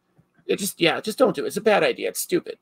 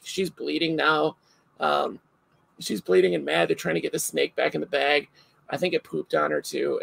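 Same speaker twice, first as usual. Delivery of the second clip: ordinary delivery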